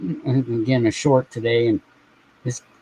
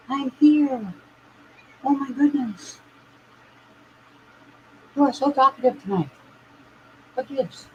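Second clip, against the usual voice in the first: softer, higher voice for a pet; pet talk; 12 semitones higher, 5 dB quieter, slower than usual. Real, not acted. Talking to the cat.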